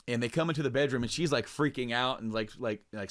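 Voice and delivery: ordinary talk, nothing marked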